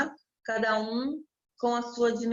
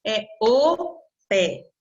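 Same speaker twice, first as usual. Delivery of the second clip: "o pé" slow and louder; firm correction; 6 dB louder, much slower than usual. Shown as a demonstration. Teacher firmly correcting "a minha pé". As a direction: stamp out "É o pé". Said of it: "Claro que não".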